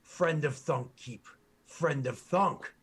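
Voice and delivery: slow broken-grammar orc boasting of his hero; proud orc guard. Acted. Orc guard proudly tells the party who Grommen is, slow boasting. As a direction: puff up and drag out each boast like a devoted grunt.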